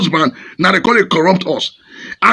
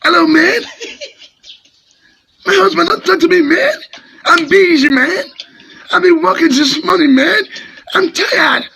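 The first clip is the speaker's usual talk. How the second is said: high, hammed-up imitation of a Westernised wife speaking English, laughing through it; mock the wife who comes back from abroad and only speaks English; mock foreign English; 7 semitones higher than usual. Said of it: "speak the way that they do am".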